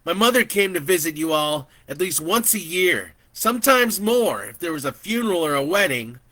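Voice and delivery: loud, rising heated accusation; angry. Acted. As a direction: let the anger build as you accuse. Narrator angry at auntie.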